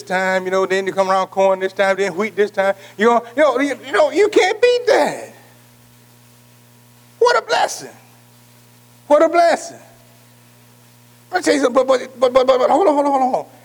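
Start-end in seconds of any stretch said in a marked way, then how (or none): 3.0-5.3 climbing much higher, slow; slow rising preacher's cry; preaching peak. Acted. 7.2-7.9 very high joyful cry; high exclamation of joy; exclaiming. Real, not acted. 9.1-9.8 higher but settling back; a lighter echo of joy; echoed praise. Real, not acted. Echoing the exclamation. 11.3-13.5 higher, insistent stops; eager hold-on calls; preaching. Acted. Preacher halting the crowd.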